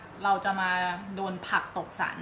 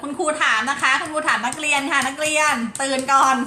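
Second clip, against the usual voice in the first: mock teacher calling the class to attention, higher and louder; เสียงครูเรียกนักเรียนแบบล้อเล่น; playful teacher voice; 4 semitones higher, 6 dB louder than usual. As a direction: rouse sleepy pupils like a bossy teacher.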